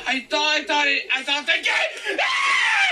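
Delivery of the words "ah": shrill startled shriek; sudden high scream. Real, not acted. Player cut off by a jumpscare, sudden high scream.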